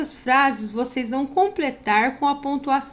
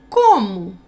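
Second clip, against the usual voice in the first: "Como?" said loud and startled; surprised question; 3 semitones lower, 8 dB louder than usual. Shown as a demonstration. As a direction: punch out "Como?" as a shocked question. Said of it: "Olha o ponto de interrogação".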